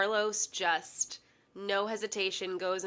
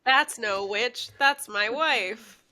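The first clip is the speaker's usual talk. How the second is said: slow, loud punchline delivery; hammy comedian; 3 semitones higher, 8 dB louder, slower than usual. Acted. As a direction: land it like a corny stand-up joke. Hammy old comedian punchline joke.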